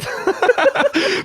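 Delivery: bursting into high laughter; cracking up at his own bit. Real, not acted. High laugh after own impression.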